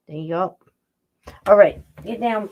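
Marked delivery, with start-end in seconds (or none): none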